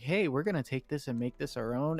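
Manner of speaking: ordinary talk, nothing marked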